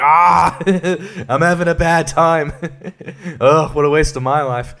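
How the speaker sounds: sarcastic parody of a suffering slave; mock lament. Mocking the film's slave scenes, a mock sigh. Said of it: "just being like".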